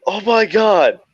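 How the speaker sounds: high, slow disbelieving whine; can't believe it; dismayed buddy. Retold or imitated.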